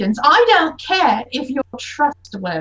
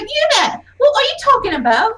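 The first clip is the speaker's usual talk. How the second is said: confused; 9 semitones higher than usual. Acted. Madonna confused, calling out.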